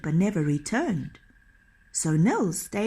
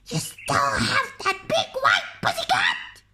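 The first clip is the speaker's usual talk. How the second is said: troll voices. A narrator's reading. Troll voice from the trees.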